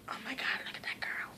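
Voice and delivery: hushed mocking whisper. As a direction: whisper it like gossip. Mocking popular kids in a whisper.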